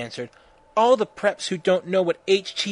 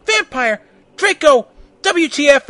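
loud, high-pitched shout; raised voice, hammed-up story shouting; 9 semitones higher, 8 dB louder than usual. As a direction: yell it out in outrage. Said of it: "shooting at each other".

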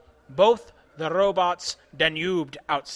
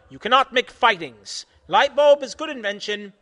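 loud scolding; 4 semitones higher, 7 dB louder than usual. Acted. Continues yelling.

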